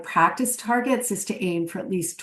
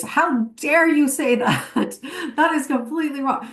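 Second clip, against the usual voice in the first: mock indignant client; 5 semitones higher than usual. Workshop leader voicing an imagined offended client, mock outrage. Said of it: "what I've never heard from a client".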